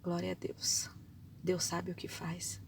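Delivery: a hushed whisper thanking God; whispered praise. Acted. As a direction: breathe it out as quiet trust.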